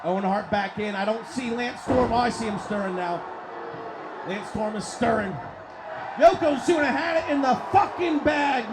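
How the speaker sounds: loud, high, excited ringside call; live play-by-play. Acted. Commentator calling a video game tag match to viewers, shouting. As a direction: call the match at full throat.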